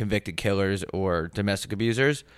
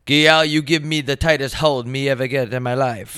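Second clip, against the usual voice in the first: put-on a light Jamaican accent reading the lyric; 5 semitones higher, 3 dB louder than usual. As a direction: quote the lyric in a mild Jamaican lilt. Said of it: "girl is spelled G Y A L".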